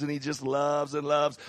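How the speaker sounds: usual speaking voice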